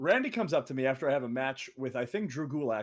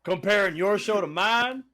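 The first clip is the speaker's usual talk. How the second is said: louder, slow, pompous delivery; imitating JBL; 4 semitones higher, 6 dB louder, slower than usual. Retelling JBL's putdown of their show, slow mock impression. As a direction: draw out the burn grandly. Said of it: "he said".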